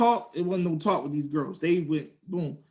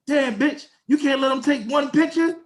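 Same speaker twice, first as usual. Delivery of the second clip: high-pitched indignant mock complaint; two girls heckling; 7 semitones higher, 3 dB louder than usual. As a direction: imitate girls griping loudly at someone. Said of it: "the two girls say".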